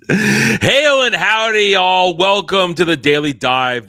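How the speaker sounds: loud, drawn-out host greeting; big announcer welcome; show intro. Acted.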